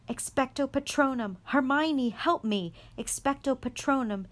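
high loud desperate casting; desperate shouts. A narrator's reading. Harry casting and crying to Hermione for help, shouting. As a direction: shout the spell for your life.

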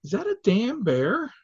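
high startled question; surprised. Retold or imitated. Friend spotting a bear on the road, startled. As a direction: blurt it out in disbelief. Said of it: "Zethan looking up and going".

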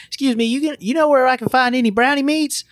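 high-pitched Georgia accent impression; thick Georgia accent. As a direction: mimic her in a high, thick Georgia twang. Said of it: "Angry Ariel has a, a very thick Georgia accent".

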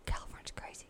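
breathy whispered aside with a sigh. Real, not acted. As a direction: sigh it under your breath. Sighing aside about California.

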